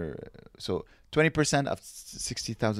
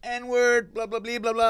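mocking high voice; 12 semitones higher, slower than usual. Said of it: "And you're just going".

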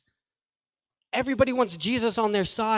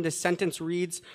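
normal talking voice

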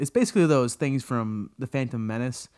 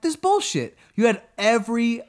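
indignant outburst; 9 semitones higher than usual. Retold or imitated. Reviewer reliving watching the scene, indignant high outburst. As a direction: blurt it out in outrage. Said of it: "But I'm like".

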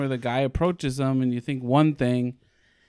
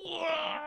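drawn-out high mock cry; imitated high-pitched wail; 8 dB quieter than usual. He was like.

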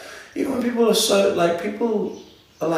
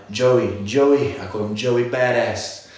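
usual speaking voice

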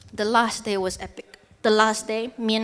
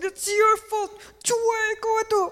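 angry accusation; 9 semitones higher than usual. Retold or imitated. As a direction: snap the blame at him. Angry crew member in retold quarrel.

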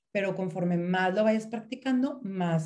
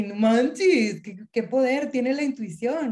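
amazed; 3 semitones higher, 4 dB louder than usual. Retold or imitated. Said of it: "yo leí esto y dije".

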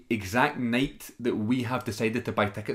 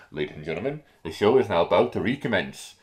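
lower-pitched American announcer voice; 4 semitones lower than usual. Retold or imitated. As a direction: imitate an American making a formal tannoy announcement. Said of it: "why it was an American accent".

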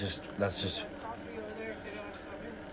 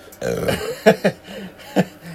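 airy laugh; 8 semitones higher than usual. Real, not acted. Laughs at friend's lake story.

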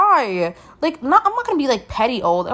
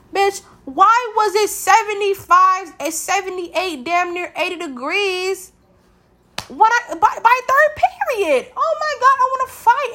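exasperated rant; 8 semitones higher than usual. Real, not acted. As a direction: build into an outraged squeal about the heat. Ranting about hot weather.

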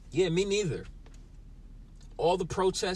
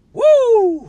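high, loud mock cheer; sarcastic whoop; 16 dB louder than usual. Host mocking the awful year with a loud whoop. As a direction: throw out a big ironic whoop.

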